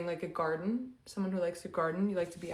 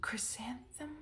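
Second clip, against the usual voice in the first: questioning whisper; 5 semitones higher, 9 dB quieter than usual. Real, not acted. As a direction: whisper it like a guess. Whispered guess at flower.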